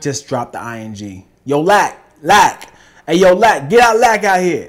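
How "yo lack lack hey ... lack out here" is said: loud yelling call, pitched up. Acted. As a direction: shout his name across the street. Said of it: "Why you yelling in my ear".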